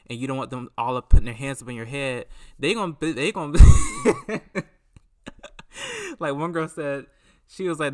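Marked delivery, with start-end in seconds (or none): 3.6-4.6 high, loud squealing laugh; sudden shrieking laugh at her own story; loud burst of laughter. Real, not acted.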